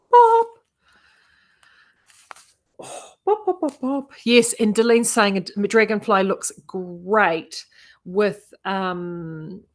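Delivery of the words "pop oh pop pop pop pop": high-pitched, sing-out pops; playful popping. Pretend. Showing viewers how the colour stands out, playful high pops. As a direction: pop it out high like a little sound effect.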